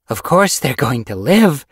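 loud, high-pitched retort; indignant cry. A narrator's reading. Yellowfang defending her unborn kits, crying out loudly. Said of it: "Yellowfang cried".

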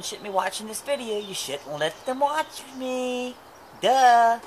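very slow, drawn-out teasing line; slow mock-stern warning. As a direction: stretch it out to tease the parents. Teasing aside about children.

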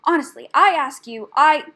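very loud, affronted protest; loud huffy outburst; indignant. A narrator's reading. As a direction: protest in outrage, pitch up. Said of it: "Rat gasped indignantly".

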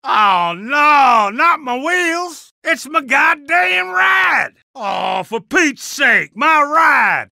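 high, slow, anguished yell; outraged wail. Acted. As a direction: howl over your wrecked car. Car wrecked, outraged.

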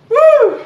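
loud high hoot of effort; high whoop. Real, not acted. Coach whoops during workout.

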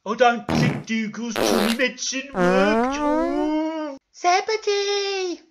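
slow wail climbing to a loud shriek; high, drawn-out mock panic; hammed-up distress. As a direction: call for help in shrieking falsetto. Character calls out for Zebedee.

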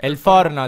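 "forno" with a clear f; correcting porno to forno; stressing forno. Shown as a demonstration. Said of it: "El porno no".

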